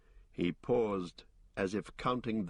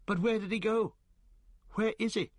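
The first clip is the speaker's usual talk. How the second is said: high, louder hobbit cry, worried; high anxious cry; 12 semitones higher, 3 dB louder than usual. A narrator's reading. Pippin fretting over missing Frodo, high anxious cry. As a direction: call out high and fretful for the lost friend. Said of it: "cried Pippin".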